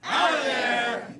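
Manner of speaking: shouted catchphrase; loud. Shown as a demonstration. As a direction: punch out of there loud. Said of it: "do that with us".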